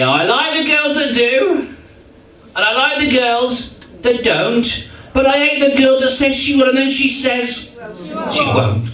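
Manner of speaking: high-pitched Cockney music-hall patter; Max Miller. Retold or imitated. Comic demonstrates his versatility, slipping into cheeky Cockney. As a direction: lilt the cheeky rhyme up high, drawing out the line ends. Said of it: "a little bit of Maxie Miller".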